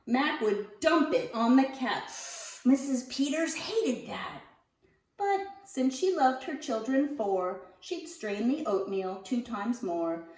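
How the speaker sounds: ordinary delivery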